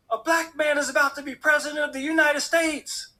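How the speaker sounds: high, emphatic proclamation of big news; overwhelmed exclamation. Retold or imitated. Neighbor's overwhelmed cry retold.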